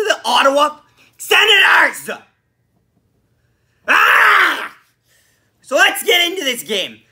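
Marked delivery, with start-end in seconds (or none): 0.0-2.1 loud, higher, anguished yell; exasperated shout. Real, not acted. Fan lamenting a loss to Ottawa, exasperated shout. Fan enraged at losing to rivals. 3.9-4.8 loud high yell of frustration; frustrated scream. Real, not acted. Fan venting after losing to Ottawa, frustrated scream.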